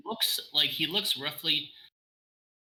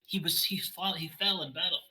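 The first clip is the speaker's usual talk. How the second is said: distressed Kira; 6 semitones higher than usual. Acted. GM voices Kira reporting king's death.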